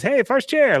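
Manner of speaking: high, loud mock protest; calling after someone. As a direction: imitate an indignant customer calling him back. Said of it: "like he's walking away from Khan".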